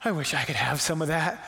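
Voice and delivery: drooling envy. Acted. Starving son eyeing pig slop, drooling envy. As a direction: savour it with hungry longing. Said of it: "as he's drooling over the slop".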